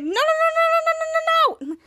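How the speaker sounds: very high rapid string of noes; squealed, hammed-up alarm; panicked protest. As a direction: rattle it out in mock panic. Kids panic as hand nears lava.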